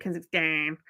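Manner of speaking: Kazakhstan with a nasal Brummie "-stan"; nasal Brummie accent. Retold or imitated. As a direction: lean into the Brummie vowel on Kazakhstan. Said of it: "I'd always like".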